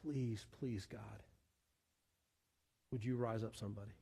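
very soft, low pleading; begging prayer. Acted. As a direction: beg God in a hushed, low voice. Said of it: "when do we beg for the lost".

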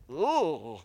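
imitating judgmental churchgoers pulling back; disgusted "ooh". Judgmental church people recoiling at newcomers, mock disgusted ooh. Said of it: "other people would have looked at and said".

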